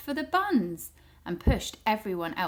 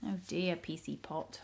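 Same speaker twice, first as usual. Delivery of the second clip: ordinary delivery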